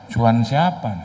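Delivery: usual speaking voice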